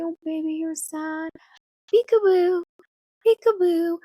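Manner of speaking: put-on high, sing-song baby talk to a sad infant. Shown as a demonstration. Parent cooing to a sad baby, high sing-song. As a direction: coo at the baby to cheer it up.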